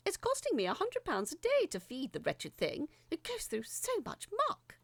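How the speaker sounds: pompous, complaining official reading out grievances; indignant letter writer. Acted. Prime Minister writing to Lisa about the creature, indignant. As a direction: huff and puff through the complaint like an affronted minister.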